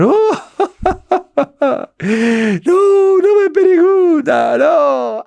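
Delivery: high, loud wailing no with a sob; sobbing refusal. Acted. Demon sobs as the believer asks his secret. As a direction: break into tears while refusing. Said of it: "empieza a llorar, llorar".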